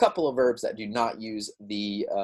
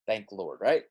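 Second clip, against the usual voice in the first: playful relief. Pretend. Teacher relieved for students that verbs are regular, sighing.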